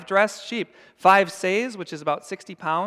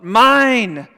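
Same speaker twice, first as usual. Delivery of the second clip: put-on loud, higher shriek of a greedy toddler; toddler yelling; 5 semitones higher, 12 dB louder than usual. Preacher imitating a toddler yelling at another child, loud and higher. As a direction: shout it like a two-year-old guarding toys. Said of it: "That two-year-old yells out".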